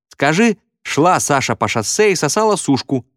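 put-on high girlish voice; high-pitched schoolgirl; girl piping up. A narrator's reading. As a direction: pipe up from the crowd with a dare. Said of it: "подала голос какая-то девочка".

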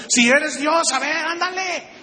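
high, daring mock challenge to God; a taunting dare thrown at God. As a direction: sneer it like a dare. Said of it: "¿Cuál fue la murmuración?".

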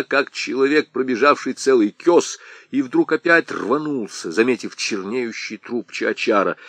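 plain reading voice

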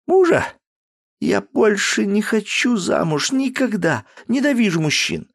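high, loud spiteful outburst; angry cry. Acted. Daughter yelling back at her father, furious. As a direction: hurl it back in anger. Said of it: "злобно выкрикнула она в ответ".

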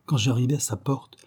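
ordinary delivery

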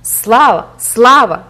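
loud, high shouted acclaim; roaring crowd. A narrator's reading. The crowd hails Jupiter, roaring. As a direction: roar it out twice like a mob. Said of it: "ревнула юрба".

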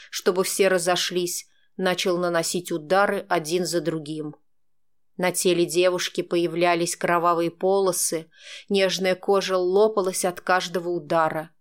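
ordinary delivery, nothing marked

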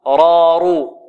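"رارو" with a full emphatic ر; heavy ra. Shown as a demonstration. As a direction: sound "رارو" thick, as ra and ru. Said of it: "والراء تفخم إذا كانت مفتوحة أو مضمومة". («رارو» براء مفخمة)

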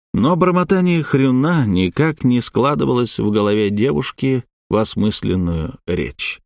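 ordinary delivery, nothing marked

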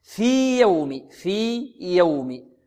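في يوم with a long "fii", then a distinct "yawm"; very slow, hold في before يوم; separated ya. Shown as a demonstration. Teacher demonstrating clear separation, separated ya. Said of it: "أظهر".

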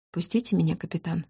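softly, very calmly; quiet and calm. A narrator's reading. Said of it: "тихо и очень спокойно сказала я". (тихо и очень спокойно)

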